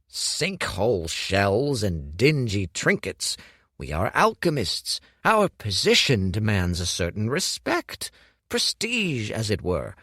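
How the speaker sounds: Hu lectures Dorian on alchemist ways, haughty; haughty old master, lecturing. Acted. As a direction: lecture the boy on tradition. Voicing Hu, disdainful.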